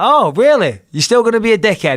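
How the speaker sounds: mock indignation. Fighter retelling taunt to rival after the win, high sarcastic jab. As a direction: needle him with fake outrage. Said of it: "I'm like".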